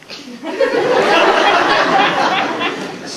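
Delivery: long high burst of laughter; delighted high cackle. Real, not acted. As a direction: crack up laughing high and loud. Laughing after [name] impression.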